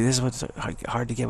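ordinary talk, nothing marked

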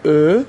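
ا as a loud, held German ö; rounded o-umlaut. Shown as a demonstration. Teacher demonstrates o-umlaut alone, ا as loud rounded ö. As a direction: say ا through rounded lips, loud and drawn out.

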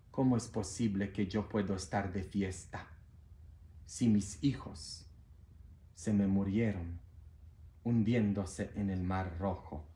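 low, soft, mournful God, a touch of tears; grieving God. Acted. God answering the angel, low and softly grieving. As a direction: speak low and hushed as a heartbroken father. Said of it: "Y Dios lo mira al ángel y le dice".

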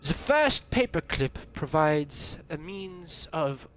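slow, hammed-up German accent; horrible German-American accent. Gamer reading a secret document aloud, bad German-American accent. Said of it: "a horrible German-American accent".

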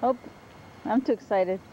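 ordinary talk, nothing marked

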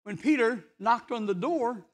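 ordinary talk

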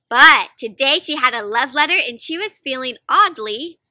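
lively read-aloud building suspense; storytime narration. A narrator's reading. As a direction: lead up to the surprise word. Reading picture book aloud.

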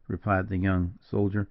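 usual speaking voice

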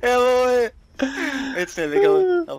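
excited. Real, not acted. Cheering after detonating charges.

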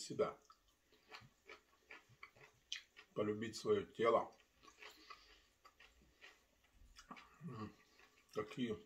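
talking with food in mouth; speaking between bites; chewing while talking. Real, not acted. Seen 0: bites cucumber at 8.0, keeps talking while chewing afterward.

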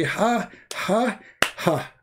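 lightly acted, spaced-out "ha"; mock devil laugh. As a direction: imitate Satan laughing, only half in earnest. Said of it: "and he say".

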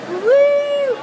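high loud whoop; excited whoop. Real, not acted. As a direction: whoop with joy. Cheering backstage.

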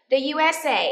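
high, loud, spelt-out letters; teacher drilling a name; model for repetition. Shown as a demonstration. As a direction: project it for the class to echo. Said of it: "listen and repeat after me".

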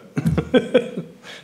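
high burst of laughter; amused laugh. Real, not acted. Board member laughing over nameless appointment in minutes, amused laugh. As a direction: laugh out at the absurdity.

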